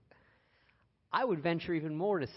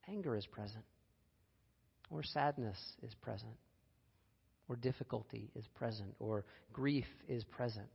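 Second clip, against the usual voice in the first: softer, calm "anger is present"; gentle noting; 7 dB quieter than usual. Shown as a demonstration. Teacher showing listeners the mindful phrase, soft and calm. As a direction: let "is present" fall softly, just observing. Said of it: "which may also leave space".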